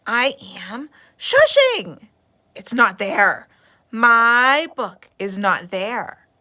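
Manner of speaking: put-on girl in library loudly says her book is gone; loud upset girl. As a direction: complain loudly, not shushing at all.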